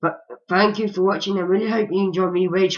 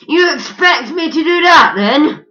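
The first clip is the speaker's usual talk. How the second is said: indignant shout; 10 semitones higher, 9 dB louder than usual. Real, not acted. Indignant at game challenge.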